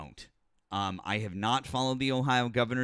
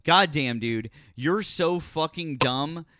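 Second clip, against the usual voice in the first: louder, heated tirade at the caller; angry rant; 6 dB louder than usual. Real, not acted. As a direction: lay into him, furious and loud. Berating a caller over sarcasm.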